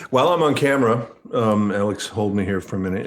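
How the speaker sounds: ordinary talk, nothing marked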